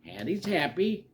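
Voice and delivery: plain reading voice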